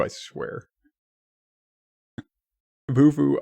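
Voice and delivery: usual speaking voice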